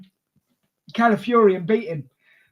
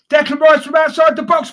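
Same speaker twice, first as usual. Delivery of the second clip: higher, louder excited commentary; excited goal call; 8 semitones higher, 8 dB louder than usual. Real, not acted.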